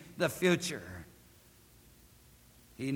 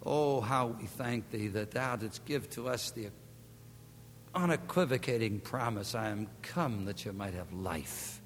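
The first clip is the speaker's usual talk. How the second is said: lower, softer, reverent closing prayer; 6 semitones lower, 3 dB quieter than usual. Acted. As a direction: pastor leading the congregation in prayer.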